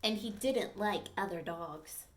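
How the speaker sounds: storybook read-aloud, clear and lively; reading to children. Acted. Teacher reading a dog story to the class, lively storytelling. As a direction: read it to the class like a picture book.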